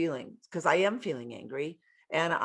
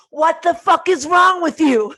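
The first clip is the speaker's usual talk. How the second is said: high, loud furious shout; mock rage explosion; angry yell; 12 semitones higher, 11 dB louder than usual. Shown as a demonstration. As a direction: blow up at them. Yelling at someone, counterexample.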